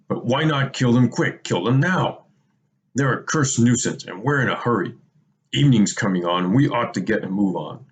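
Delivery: a mildly voiced orc who wants the prisoners dead now; impatient orc. A narrator's reading. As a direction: press them to kill the prisoners now. Second orc urges killing.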